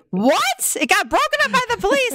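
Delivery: high, fast incredulous alarm; shocked reaction. Shown as a demonstration. Mom showing the wrong shocked reply to her son. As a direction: overreact like a shocked parent. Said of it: "That was not what I said".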